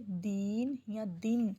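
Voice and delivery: slow, drawn-out دین then دن, the vowel clearly changed; teacher contrasting دین and دن slowly; slow vowel contrast demo. Shown as a demonstration. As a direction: show the long ee of دین against the short i of دن. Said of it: "جس طرح ہم دن نہیں بول سکتے".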